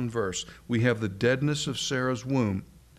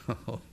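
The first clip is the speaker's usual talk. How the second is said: soft little laugh with an "oh"; hushed, self-amused chuckle on "oh"; quiet chuckle; 7 dB quieter than usual. Acted. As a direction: chuckle privately to yourself, very softly. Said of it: "little quiet chuckle to herself".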